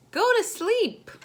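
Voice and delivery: high, loud mock parent command; half-playful go to sleep order; parent ordering bed. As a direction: bark it like a tired parent. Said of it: "mom and dad tell you".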